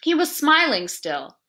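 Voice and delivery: ordinary delivery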